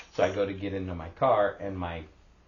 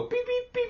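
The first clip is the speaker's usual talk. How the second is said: fob beep imitation. Imitating car fob chirp.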